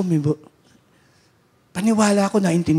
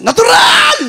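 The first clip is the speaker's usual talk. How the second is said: higher, much louder furious yell; angry drunk's shout; 10 dB louder, slower than usual. Retold or imitated. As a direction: explode like the angry old man. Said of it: "lalong nagalit siya". (galit na sigaw ng matanda)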